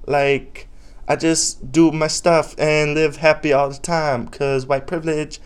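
high, loud mocking valley voice.

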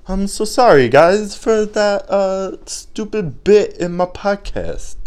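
high, drawn-out put-on voice; mock apology. Exaggerated apology for failed bit.

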